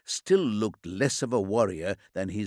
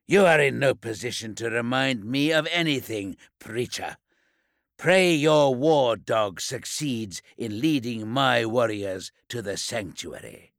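scornful warlord. Acted. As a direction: put him in his place coldly. Lesteigen dismissing the Preacher.

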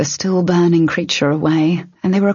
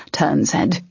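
usual speaking voice

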